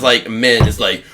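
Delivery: ordinary talk, nothing marked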